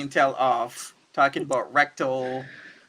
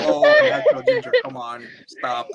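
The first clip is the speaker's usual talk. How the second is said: high, fast, giggling gush; mock flirty gush; 4 dB louder than usual. Fan gushing at Natural Ginger, high and giggly. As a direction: swoon over her name, laughing, then drop to a breathy stop.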